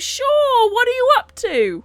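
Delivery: high-pitched, sing-out casual reply; put-on high bishop voice; breezy bishop.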